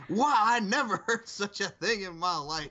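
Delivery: high, slow, hammed-up affront; slow high-pitched mock outrage; mock indignation. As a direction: act comically scandalised, laugh breaking in. Acting R-Truth's reaction to Japanese.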